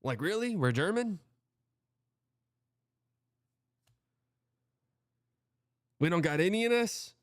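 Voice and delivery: high, rising incredulous questions; mock disbelief. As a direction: plead with relatives in exaggerated disbelief. Retold questioning of family, disbelieving.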